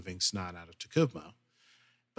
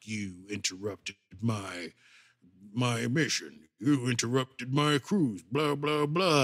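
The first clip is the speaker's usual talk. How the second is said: cranky Klingon; slower than usual. Retold or imitated. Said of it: "and they're like".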